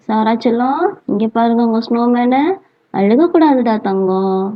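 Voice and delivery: low, soothing motherly endearments; tender coaxing. Acted. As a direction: coax a sad child to look. Mother shows child new snowman.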